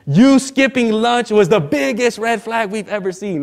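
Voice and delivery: drawn-out mock indignation; retold accusation. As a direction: imitate friends confronting you, word by word. Friends confront him, retold.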